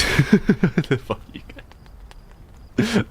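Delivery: breaking up laughing, words drawn out. Real, not acted. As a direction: laugh helplessly through the curse. Repeats it while breaking into laughter.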